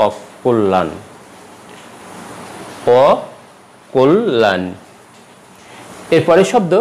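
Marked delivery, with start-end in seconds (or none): 0.1-0.9 অকল্যাণ said the right way, falling; অকল্যাণ clearly and correctly pronounced; correct pronunciation. Shown as a demonstration. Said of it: "শব্দটি উচ্চারণ হবে". 3.0-4.6 অকল্যাণ drawn out very slowly, every sound clear; অকল্যাণ said very slowly and carefully; slow correct pronunciation. Shown as a demonstration. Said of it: "শব্দটি উচ্চারণ হবে".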